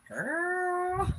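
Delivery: put-on high, loud drawn-out Philly "Girl". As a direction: stretch "Girl" out like a Philly auntie.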